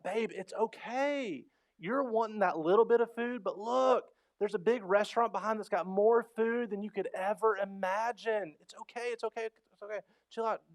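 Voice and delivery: put-on high, soothing pleading to a child; consoling. Dad calming his daughter outside the restaurant, high and soothing. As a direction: talk her down, gently but insistent. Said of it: "I'm like".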